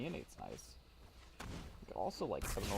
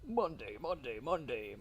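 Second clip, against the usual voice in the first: monster truck ad; 4 semitones higher, slower than usual. Pretend.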